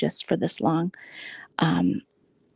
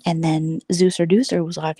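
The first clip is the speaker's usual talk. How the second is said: ordinary delivery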